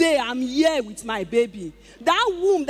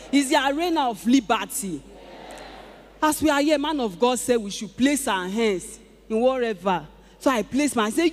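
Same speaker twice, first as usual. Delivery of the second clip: ordinary delivery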